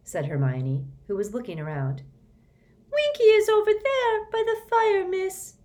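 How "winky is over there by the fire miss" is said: high squeaky elf, not really quiet; a squeaky little elf, subdued; house elf voice. Acted. Dobby telling Hermione where Winky sits, squeaky. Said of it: "said Dobby quietly".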